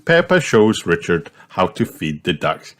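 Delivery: ordinary delivery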